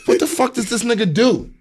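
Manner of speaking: lightly voiced confused dad asking; mock bewildered father; imitating father. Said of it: "he said".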